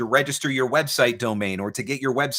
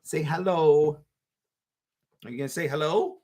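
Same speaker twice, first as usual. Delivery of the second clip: higher, stretched coaxing hello; 4 semitones higher than usual. Real, not acted. Dad coaxing his baby to greet viewers, higher baby talk.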